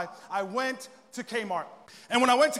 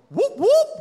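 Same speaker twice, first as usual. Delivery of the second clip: loud, high rising whoops; sudden shrill siren noise; police siren imitation; 14 semitones higher, 10 dB louder than usual. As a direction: whoop like a squad-car siren. Imitates police siren as they pounce.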